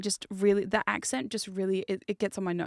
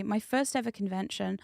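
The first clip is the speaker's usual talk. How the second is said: ordinary talk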